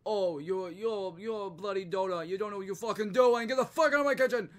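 loud, high, fast-building chef tirade; angry Gordon Ramsay. Retold or imitated. Chef throwing a cook out of his kitchen, angry shouting. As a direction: berate a useless cook at rising volume. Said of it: "usually he's like".

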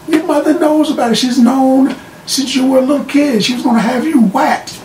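high, pressing mock accusing voice; acted accusation. Accuser tells the Penguin his mother knows, accusing.

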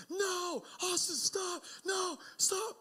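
high-pitched mock panic yell; panicked dad. Dad yelling at his son chasing a kite, high-pitched panic. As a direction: call out frantically, imitating a panicked father. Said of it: "panic and going".

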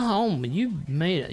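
ordinary talk

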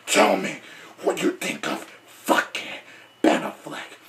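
deep, breathy growling superhero rasp; Batman. Acted. Batman tells viewers to subscribe and comment, deep hoarse growl. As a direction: growl it very low and half-whispered, in menacing chunks. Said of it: "as Batman would say".

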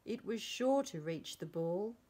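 ordinary delivery

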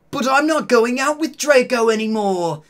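high, loud character voice; Vampire's voice. A narrator's reading. Boy protests he's done with Draco, high and loud. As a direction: pitch it up and push it out. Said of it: "said Vampire".

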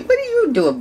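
very high coo at a puppy. Real, not acted.

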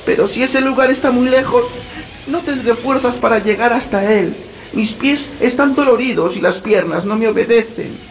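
put-on high, weary small girl; little girl voice. A narrator's reading. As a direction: whine like a tired child. Tired Gretel complains.

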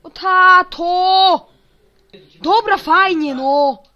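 high, very loud yell. Real, not acted. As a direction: shout it out, stretching the words. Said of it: "Nie podnoś głosu".

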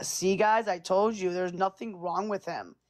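ordinary talk

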